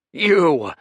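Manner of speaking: high, loud furious bellow; angry roar. Acted. Grieving son roaring at the intruder, full fury. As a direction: hurl the word at him in rage.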